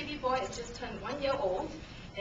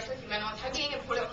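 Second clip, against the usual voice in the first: normal talking voice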